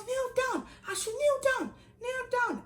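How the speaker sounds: shrill bossy senior. Retold or imitated. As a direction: repeat the order, fed up. Senior repeating the order.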